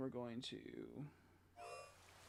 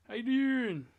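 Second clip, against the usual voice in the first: excited greeting; 9 semitones higher, 7 dB louder, slower than usual. Real, not acted. Greeting viewer on stream.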